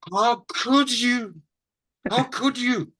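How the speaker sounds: aghast, swooping high on each outcry; theatrically appalled. Retold or imitated. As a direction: gasp in hammed-up dismay at a decor crime. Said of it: "He'd look and he'd go".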